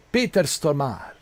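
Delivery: ordinary delivery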